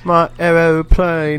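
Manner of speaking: put-on British accent. Covering the Chili Peppers song, mock British accent. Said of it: "why'd you make them British?".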